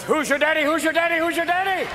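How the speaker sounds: high, climbing mock-triumphant chant; hammed-up high gloating; cocky taunt. Acts triumphant skating lift.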